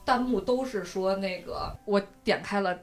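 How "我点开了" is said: normal talking voice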